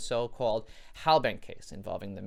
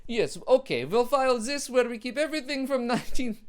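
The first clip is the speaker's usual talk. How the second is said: hammed-up German accent, higher and louder; bad German accent; 12 semitones higher, 7 dB louder than usual. German official filing Merkel calls, bad German accent. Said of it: "I can't do a German accent".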